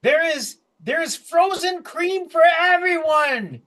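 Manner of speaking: high, slow proclamation; triumphant gnome. Acted. Gnome announces frozen cream to crowd, high triumphant voice. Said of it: "And he goes".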